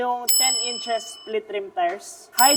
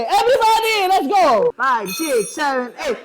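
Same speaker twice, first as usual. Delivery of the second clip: hype count-in; 4 semitones higher, 7 dB louder than usual. Real, not acted. Leading a group dance count.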